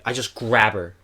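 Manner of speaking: usual speaking voice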